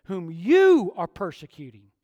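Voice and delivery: loud, pointed accusation; Jesus calling Saul to account; emphatic Jesus. Acted. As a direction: hammer the word you. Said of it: "He says".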